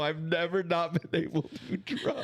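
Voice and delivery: high-pitched, upset lament; close to crying. Real, not acted. Host who has never missed drumming, tearful. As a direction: pitch it high, as if about to cry. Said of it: "I might start crying".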